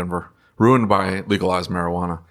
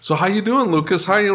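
higher, sing-song imitation of people greeting the wrong person; mock greeting; 10 semitones higher than usual. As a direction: play the guest addressing the wrong man, bright and high. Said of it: "they look at me and they go".

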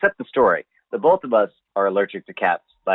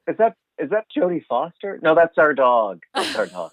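mock startled; 6 semitones higher than usual. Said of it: "You're like".

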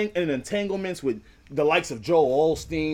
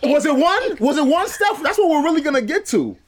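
teasing mock question; 7 semitones higher, 3 dB louder than usual. Real, not acted. Teasing the deacon about the pastor.

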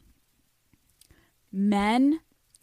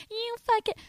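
mock scream; 14 semitones higher than usual. Screaming at him.

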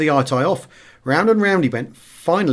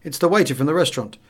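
usual speaking voice

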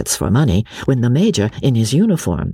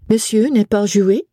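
higher-pitched masked lady asking in French; French speech; 11 semitones higher than usual. A narrator's reading. Masked lady asks the boy about play, in French. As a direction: ask it as a French-speaking lady.

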